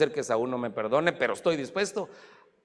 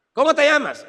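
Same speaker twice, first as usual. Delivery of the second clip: loud, high demand; stern questioning; 11 semitones higher, 8 dB louder than usual. Acted. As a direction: fire the question at Jacob as God. Acts God questioning Jacob again.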